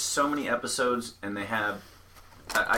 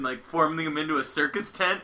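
normal talking voice

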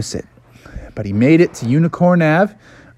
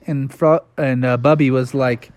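ordinary delivery

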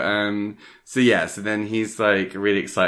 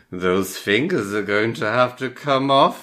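louder, higher mock professor pronouncing doom; mock doctor's verdict; 6 semitones higher, 4 dB louder than usual. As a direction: announce it like a grim doctor. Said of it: "he's like".

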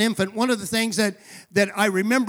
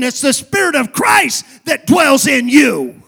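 building from firm to loud, fervent proclamation; full-force preaching; 3 semitones higher, 6 dB louder than usual. Real, not acted. Preacher telling the church who Jesus is, building fervent preaching. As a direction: drive the sermon up to a ringing climax.

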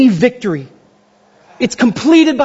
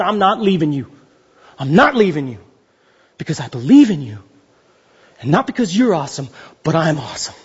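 tender God; 7 semitones lower than usual. Retold or imitated. God promising the believer he stays, low and tender. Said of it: "And he says".

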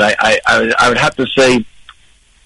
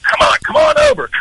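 imitating Vic beckoning. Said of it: "behind the stage waving".

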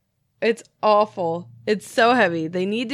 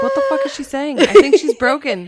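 baffled onlookers; 4 semitones higher, 5 dB louder, faster than usual. Retold or imitated. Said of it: "they're like".

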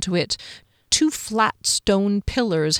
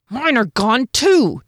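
slow, louder announcement; announced; 5 semitones higher, 5 dB louder, slower than usual. A narrator's reading. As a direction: declare it to all. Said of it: "Janek announced".

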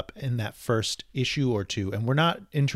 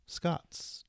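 whisper; 6 semitones higher, 12 dB quieter than usual. Real, not acted. Hushed aside.

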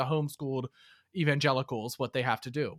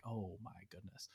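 whispered gasp; 7 semitones lower, 22 dB quieter than usual. Retold or imitated. Her reaction to inspector.

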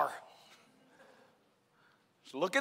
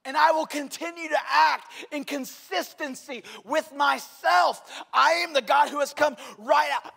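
loud, high, thundering sermon delivery; full-force preaching; 5 semitones higher, 6 dB louder than usual. Acted. Pastor booming God's words to the congregation, loud and high. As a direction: boom God's words out over the church. Voicing God, preaching hard.

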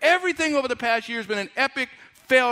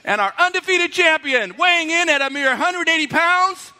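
loud, higher champion introduction; ring announcer; 7 semitones higher, 6 dB louder than usual. Acted. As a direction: build to the champion's name. Mock boxing ring introduction.

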